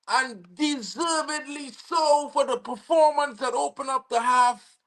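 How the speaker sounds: ordinary delivery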